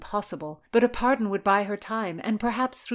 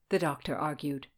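ordinary delivery, nothing marked

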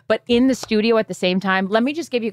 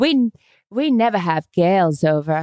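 put-on English accent. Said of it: "with their little English accents".

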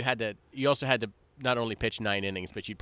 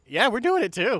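mock eager voice; 10 semitones higher, 7 dB louder than usual. Mocking fellow time travelers.

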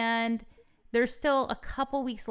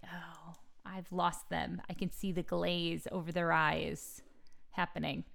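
mock whisper; 4 semitones lower, 9 dB quieter than usual. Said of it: "And I'm like".

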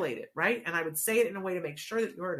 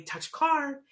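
higher, upbeat "Touch car"; cheerful bright command to a child; exciting; 8 semitones higher than usual. Shown as a demonstration. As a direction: sell "Touch car" like it's a treat. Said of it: "and make it exciting".

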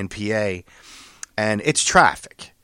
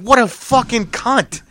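mock outraged agreement; 10 semitones higher, 3 dB louder, slower than usual. Agreeing with other driver.

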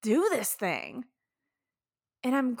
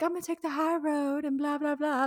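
sweet high road; 5 semitones higher than usual. Retold or imitated. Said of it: "be all sweet and be like".

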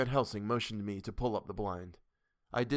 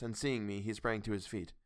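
plain reading voice

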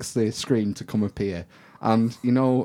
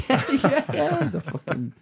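laughing; slower than usual. Real, not acted. Laughing at the GoPro suggestion.

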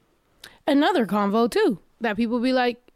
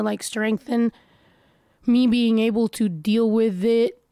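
ordinary delivery

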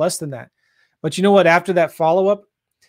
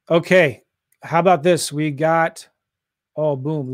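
usual speaking voice

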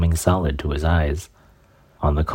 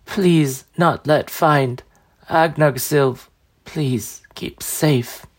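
slow, wet, raspy dying crone; raspy gurgling voice; 10 semitones higher, much slower than usual. Acted. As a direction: gurgle the words through blood. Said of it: "raspy, gurgling, wet voice".

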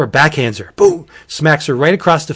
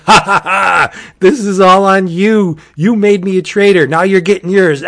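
higher, louder gloating cackle into taunt; mocking ghost laugh; 6 semitones higher, 6 dB louder than usual. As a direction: laugh at her like a spiteful ghost. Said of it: "he's pointing at her and he's laughing".

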